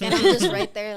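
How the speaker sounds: can't hold back laughter; real laughter breaking into the line; laughing. Real, not acted. Laughing while telling story.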